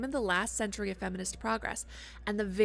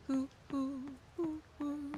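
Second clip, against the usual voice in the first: softer, higher hooting who; owl hoot; 4 semitones higher, 7 dB quieter, slower than usual. Pretend. Player hooting like an owl while searching.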